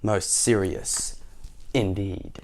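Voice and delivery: very slow, low solemn soldier; ponderous storybook guard; grave soldier. Acted. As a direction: drag it out low with heavy gravity. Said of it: "replied the soldier".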